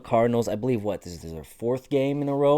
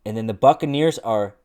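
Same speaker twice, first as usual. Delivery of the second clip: ordinary delivery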